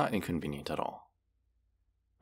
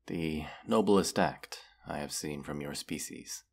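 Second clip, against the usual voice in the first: ordinary talk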